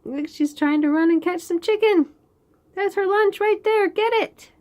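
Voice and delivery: root for her to catch it; excited cheering. Real, not acted. Cheering on girl catching chicken.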